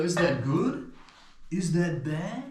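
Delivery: ordinary talk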